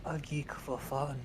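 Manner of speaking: put-on drawn-out amateur British accent. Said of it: "his most Britishy Britishness".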